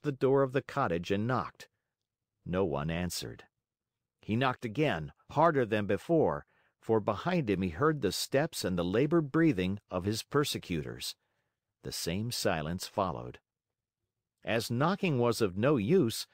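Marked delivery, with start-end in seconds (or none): none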